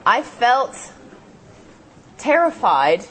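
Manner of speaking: usual speaking voice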